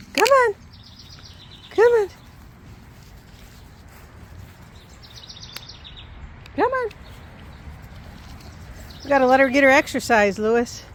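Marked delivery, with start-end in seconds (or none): 0.1-6.9 high sing-song come on to the dog; high-pitched calling a dog; high coaxing call. Real, not acted. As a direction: coax the puppy over, pitched way up.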